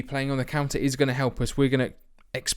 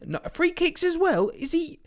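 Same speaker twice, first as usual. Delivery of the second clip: much higher, squeaky mock outrage; incredulous retort. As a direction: play up the disbelief. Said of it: "And I'm saying".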